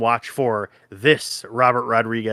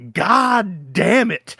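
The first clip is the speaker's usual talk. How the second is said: slow, higher, dragged-out mock movie curse; gruff movie line; 8 semitones higher, much slower than usual. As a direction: ham up the actor's opening line slowly. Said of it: "is him going".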